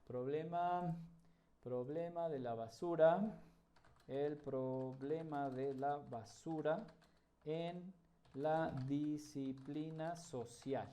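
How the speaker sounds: very soft, slow, spaced-out words; typing aloud. Shown as a demonstration. Murmuring search words while typing.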